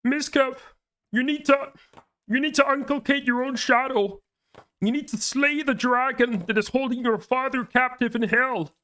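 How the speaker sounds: high, grandiose self-help preaching; hammed-up Jordan Peterson-style sermon; mock guru lecture. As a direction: lecture him like a pompous life coach.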